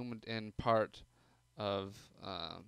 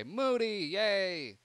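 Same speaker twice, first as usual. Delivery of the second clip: high, loud happy cheer; excited cheer; 10 semitones higher, 10 dB louder, much slower than usual. Real, not acted. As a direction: greet him with a delighted cheer. Cheering favourite character.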